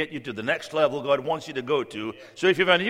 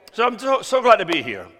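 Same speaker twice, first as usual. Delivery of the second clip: excited greeting; 8 semitones higher, 7 dB louder than usual. Real, not acted. Preacher greets congregation fervently.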